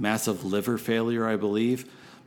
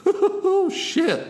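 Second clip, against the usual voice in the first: sudden high startled yelp; surprised; 5 dB louder than usual. Acted. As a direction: blurt it out, caught off guard. DJ caught off guard on air.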